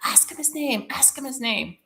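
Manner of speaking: high, repeated spirit urging; imitating many spirits; insistent spirit voices. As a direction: chant it high, like a chorus of spirits nagging. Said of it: "there were so many spirits".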